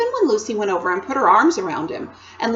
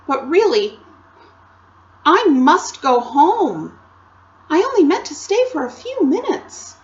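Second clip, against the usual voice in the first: apologetic Lucy; 7 semitones higher, 6 dB louder than usual. Acted. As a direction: plead sweetly that you must leave, higher and louder. Narrator voices young Lucy.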